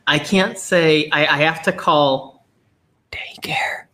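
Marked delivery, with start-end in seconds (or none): 3.1-3.8 slow whispered spelling; hushed, slow letters. Real, not acted. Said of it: "I had to whisper it again".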